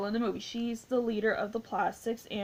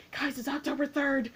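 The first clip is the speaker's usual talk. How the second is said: higher, animated mock announcement; imitated excited fans; 5 semitones higher than usual. Imitating people online telling everyone the date, high and excited. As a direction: announce it to everyone with a laugh ready. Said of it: "people are like".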